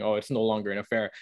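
ordinary talk

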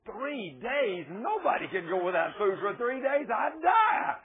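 building to a high, loud shout; mock outraged disbelief. Caller's shocked protest, retold.

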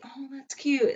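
soft, high little "that's cute"; politely patronizing. Retold or imitated. Daughter humoring mom's job tip, mock-sweet. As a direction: humor her in a small, high voice.

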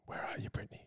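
mock séance whisper, calling her name; spooky whisper. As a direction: whisper as if summoning a spirit. Mock calling spirit, séance idea.

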